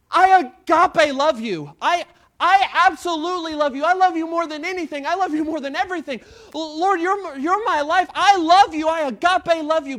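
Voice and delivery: loud, high outpouring of love; a full-voiced cry of devotion from an eager disciple; bursting declaration. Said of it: "he's wanting to burst".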